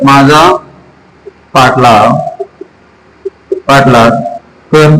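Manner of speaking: low, spaced-out repeat for learners; dictation pace. Shown as a demonstration. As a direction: say it word by word, dropping low, with long gaps. Slow repeat for learners to note. (सावकाश, थांबून थांबून खालच्या सुरात)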